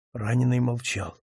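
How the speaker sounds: ordinary delivery, nothing marked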